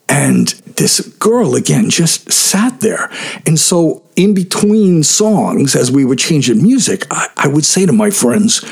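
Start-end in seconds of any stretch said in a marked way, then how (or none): none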